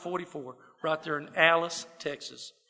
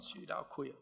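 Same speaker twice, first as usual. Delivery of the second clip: muttered thought; 15 dB quieter than usual. Retold or imitated.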